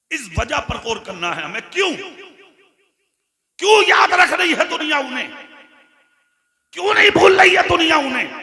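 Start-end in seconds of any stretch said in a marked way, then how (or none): 1.7-8.3 high, ringing rhetorical questions; impassioned preaching. Real, not acted. Preacher asking why the world remembers him, impassioned. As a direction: throw the question out to the crowd.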